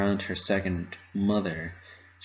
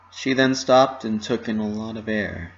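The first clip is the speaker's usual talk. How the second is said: ordinary delivery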